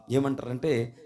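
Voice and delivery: normal talking voice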